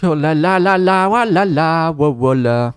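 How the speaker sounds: loud, high, slowed panicked shouts; panicked yelling. Real, not acted. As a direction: yell in panic at the screamer. Screamer in game.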